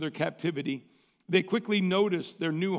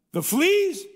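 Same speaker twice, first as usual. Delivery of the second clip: high, disbelieving question; can't believe what she's hearing; incredulous; 10 semitones higher, 4 dB louder than usual. A narrator's reading. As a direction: sister balking at thanking God for fleas, incredulous. Corrie incredulous at Betsy's thanks.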